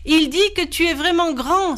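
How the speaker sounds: high-pitched, eager exclamation; bright cover-up, pitched well up; exclaiming. A narrator's reading. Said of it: "s'exclama Boone Boy".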